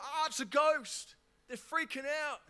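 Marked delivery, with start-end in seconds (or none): 0.0-1.1 scared. Retold or imitated. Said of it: "they're like".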